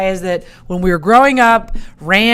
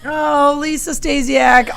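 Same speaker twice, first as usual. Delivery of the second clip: excited; 9 semitones higher, 4 dB louder, slower than usual. Real, not acted. Excited greeting of a listener.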